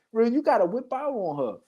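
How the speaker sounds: ordinary talk, nothing marked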